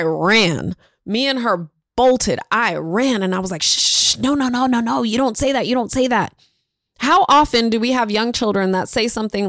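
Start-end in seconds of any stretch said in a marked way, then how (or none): 3.6-6.3 acted, urgent hushing of a child; mock-flustered scolding; retold mortified shushing. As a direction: imitate a mortified godmother shushing. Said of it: "I was mortified".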